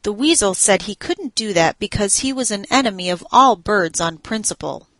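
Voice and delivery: plain reading voice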